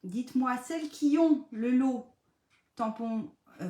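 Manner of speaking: ordinary talk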